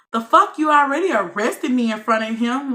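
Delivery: loud, high, indignant outburst; retold angry outburst at the cop; furious retort. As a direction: hit back hard at the officer. Snapping back at officer.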